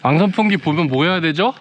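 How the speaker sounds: normal talking voice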